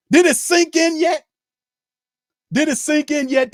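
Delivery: high, loud demanding shout; yelling at the team about their losing record. Real, not acted. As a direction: hammer the question home.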